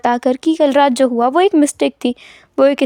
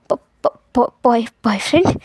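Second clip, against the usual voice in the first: stunned stammer; 3 semitones lower than usual. Acted. Stammering in shock at question.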